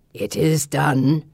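low, very slow creature growl; gargoyle growl. A narrator's reading. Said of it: "he growls".

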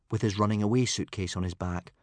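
ordinary delivery, nothing marked

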